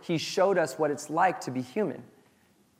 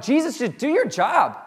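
mock-exasperated plea, pitched high and louder; freaking out; 7 semitones higher, 6 dB louder than usual. Disciples imitated pleading with Jesus mid-storm, mock freak-out. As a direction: imitate a panicky demand. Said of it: "they're freaking out".

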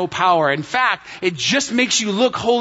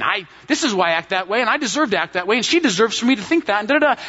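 fast, run-on mock rant; spinning self-justification; 4 semitones higher, faster than usual. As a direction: rattle off excuses, piling one on another. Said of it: "you can just be spinning".

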